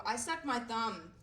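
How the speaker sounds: ordinary talk, nothing marked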